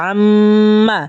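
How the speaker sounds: "আম্মা" with the double m hummed long, short final a; level chanted hum inside "আম্মা"; ghunnah nasal hold. Shown as a demonstration. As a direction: linger on the m of "আম্মা". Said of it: "গুণনা, ঠিক আছে?".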